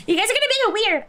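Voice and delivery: loud, high embarrassed complaint; flustered protest. Real, not acted. Streamer protesting to chat, loud and flustered. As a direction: push back at the teasing chat.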